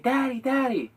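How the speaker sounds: put-on high, childlike voice calling out; child calling daddy. Little son calling to his daddy across the mall, high childlike cry. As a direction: imitate a small boy crying out for his father.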